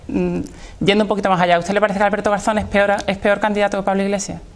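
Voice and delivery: ordinary talk, nothing marked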